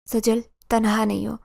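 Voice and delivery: plain reading voice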